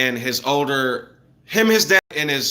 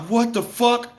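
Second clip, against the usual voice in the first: startled; 8 semitones higher, slower than usual. Retold or imitated. Startled by a boom.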